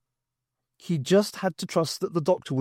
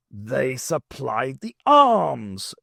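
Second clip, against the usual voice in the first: very slow, louder emphatic; realising cry; 4 dB louder, much slower than usual. Acted. Brigadier realising Paracon supplied the arms, emphatic. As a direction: land the realisation. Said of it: "cried the Brigadier".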